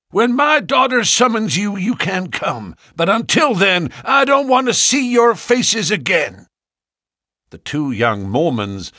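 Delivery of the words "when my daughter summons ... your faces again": put-on high, loud, stern old Ferrier; angry old farmer. Old farmer confronting two young Mormons, angry and loud. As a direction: bark at the unwelcome suitors.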